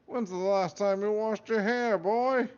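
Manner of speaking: high, loud mocking Scottish scold; Scottish hat voice. Hat insulting the boy, loud Scottish mockery. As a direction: sneer at him in a Scottish brogue. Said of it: "Scottish accented voice".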